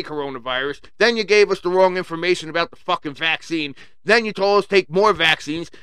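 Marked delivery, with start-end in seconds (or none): none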